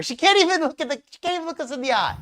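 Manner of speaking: high-pitched indignation; accuse, incredulous and worked up; real outrage. Real, not acted. Host points out a liar's evasion, indignant. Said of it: "I'm so angry".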